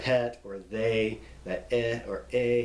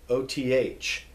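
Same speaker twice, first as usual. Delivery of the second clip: ordinary delivery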